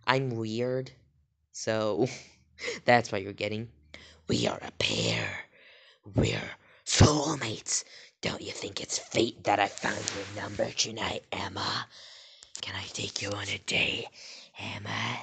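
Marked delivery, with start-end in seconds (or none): 4.3-7.8 low stalker whisper; creepy whisper. Acted. Dan telling Emma they are a pair, in a low whisper. 8.2-15.2 creepy whisper. Acted. Dan asking Emma on a date, in a creepy whisper.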